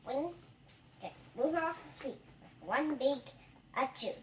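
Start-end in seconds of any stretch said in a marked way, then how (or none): none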